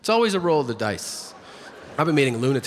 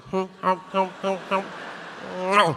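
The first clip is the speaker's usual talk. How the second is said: woodpecker pecking noise; 4 semitones higher than usual. Retold or imitated. Comic telling a crowd how the bird went, mock pecking hums. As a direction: hum out the pecks of a shot bird. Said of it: "so it was going like".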